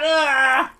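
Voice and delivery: high disgusted shriek; disgusted scream. Real, not acted. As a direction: screech in disgust. Disgusted at floor bean.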